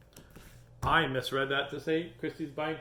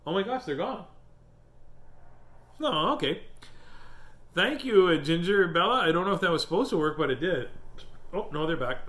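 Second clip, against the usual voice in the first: excited; 5 semitones higher, 5 dB louder than usual. Real, not acted. Hiccups gone, excited surprise.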